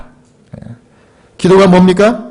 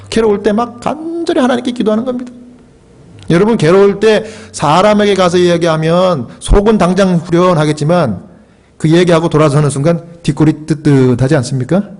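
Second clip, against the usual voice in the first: ordinary delivery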